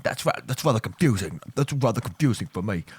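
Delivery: normal talking voice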